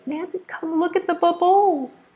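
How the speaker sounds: put-on high sing-out child voice; excited little girl. Little girl repeating her call to teacher, excited and high. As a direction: bubbles misstressed as bub-BULLS, very high. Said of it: "the little girl would say".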